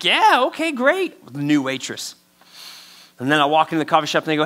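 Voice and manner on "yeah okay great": much higher, forced chipper yes; mock fake cheer. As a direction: squeak out a fake happy okay. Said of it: "And I'm like".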